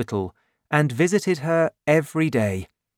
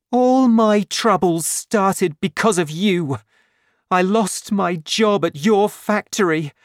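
higher, louder, angry reproach; bitter accusation; 9 semitones higher, 5 dB louder than usual. Acted. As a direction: hurl the blame at him, near tears. Fantine accuses the mayor.

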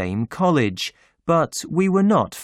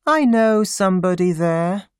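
unimpressed; 7 semitones higher, 3 dB louder than usual. A narrator's reading. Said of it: "in a bored voice".

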